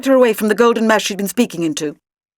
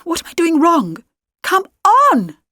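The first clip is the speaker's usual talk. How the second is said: frustrated; 9 semitones higher than usual. A narrator's reading. Frustrated trying to make disc work.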